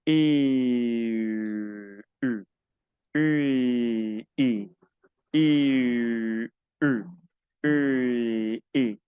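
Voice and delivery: long I sliding into a rounded French U and back; vowel glide exercise. Shown as a demonstration. Teacher shows a home exercise to students, long gliding I and U. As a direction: draw out the I, round the lips into U, stop, glide back. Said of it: "hacer el siguiente ejercicio para practicarlo".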